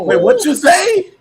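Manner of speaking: high slow disbelieving cry; shocked. Real, not acted. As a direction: ask in disbelief.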